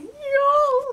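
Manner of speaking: high, loud burst of joy; excited yell. Real, not acted. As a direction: yell it out in delight. Excited about boots arriving.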